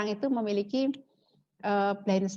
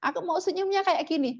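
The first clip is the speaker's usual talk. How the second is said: higher, lightly voiced little child; imitating her child; 9 semitones higher than usual. Mother quoting her youngest child about her smile, light childish voice. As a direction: chirp it like her youngest kid pointing at a photo. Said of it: "dan dia mengatakan".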